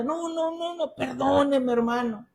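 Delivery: high, pleading imitation of an elderly churchwoman; old woman apologizing. Old churchwoman insisting the preacher forgive her, pleading. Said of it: "una viejita de".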